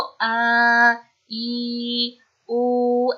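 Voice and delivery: आ, ई, उ dragged out slowly as the wrong reading; drawn out. Shown as a demonstration. Teacher showing pupils how not to read the short vowels, drawn out. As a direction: stretch each of आ, ई, उ into a long, held vowel. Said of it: "ऐसे नहीं पढ़ना है".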